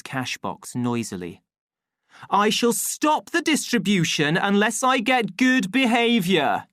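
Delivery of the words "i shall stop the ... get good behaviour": high, loud, stern threat; angry shout. A narrator's reading. Official threatens to stop distribution, angry shout. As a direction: scold the crowd as an irate official. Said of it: "shouted the official angrily".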